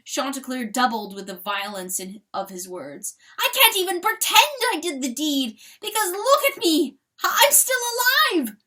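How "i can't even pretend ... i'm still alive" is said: very high, loud, rushing cry; anguished cry. A narrator's reading. Rooster crying out his shame, high, loud and fast. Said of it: "he cried".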